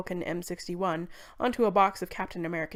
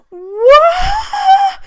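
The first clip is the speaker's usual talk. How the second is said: screaming wail of shock; 18 dB louder than usual. Acted. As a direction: scream it right into the mic. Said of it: "Princess Cindercat wailed so loud".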